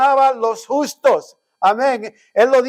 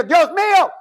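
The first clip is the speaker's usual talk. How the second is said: retold yelling prayer; 9 semitones higher than usual. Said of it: "le estaba gritando a Dios".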